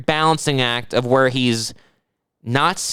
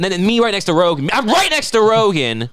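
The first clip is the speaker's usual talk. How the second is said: higher and louder, proud to sit by Rogan; excited boast; 7 semitones higher, 4 dB louder than usual. Real, not acted. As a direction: brag about sitting next to Rogan. Boasting about sitting by Rogan.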